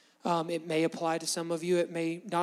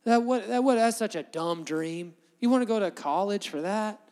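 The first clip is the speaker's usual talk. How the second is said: mocking, louder scoff at a big dream; scornful doubter; 4 semitones higher, 6 dB louder than usual. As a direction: sneer at the dream like a naysayer.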